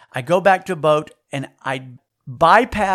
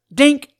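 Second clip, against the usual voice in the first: sound effect; 9 semitones higher, 8 dB louder than usual. Retold or imitated. Gear catches on rock.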